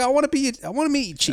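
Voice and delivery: high-pitched, overeager mock voice; hammed-up eagerness. Mocking his own keenness to meet the Parisian, hammed-up high voice. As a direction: play a gushing fan, way up high.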